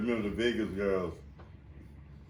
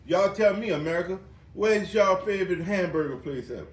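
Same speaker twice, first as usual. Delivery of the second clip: calling out; 6 semitones higher, 6 dB louder than usual. Real, not acted. Addressing the audience.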